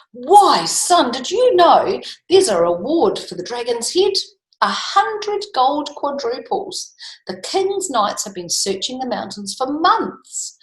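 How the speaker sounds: high, excited father's voice; light up at the idea of gold; father telling son about the king's reward; eager father. A narrator's reading.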